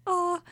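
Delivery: high, sustained choir-preset "ah"; imitated keyboard choir. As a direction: sing out one keyboard choir note. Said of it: "touch a note and it goes".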